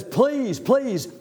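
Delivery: high-pitched acted plea; urgent pleading. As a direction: beg for help as the desperate man. Man falls at Jesus' feet, begging.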